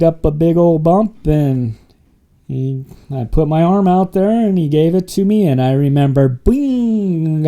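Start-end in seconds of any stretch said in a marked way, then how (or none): 6.3-7.5 high, ringing "bing" sound effect; drawn-out high bing as it hits; a long, high-pitched zing of a rush; imitated rush hit.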